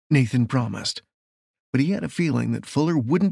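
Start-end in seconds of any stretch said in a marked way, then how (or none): none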